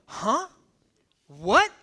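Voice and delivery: rising, puzzled questions; mock baffled apostles. Apostles pulling Jesus aside, baffled and questioning. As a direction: sound comically lost. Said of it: "the apostles bring him to the side and go".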